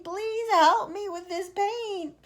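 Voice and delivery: high, loud, slow pleading; centipede bawling. Acted. Centipede bawling to James to wash off the paint. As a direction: beg desperately for help. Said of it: "bawled the centipede".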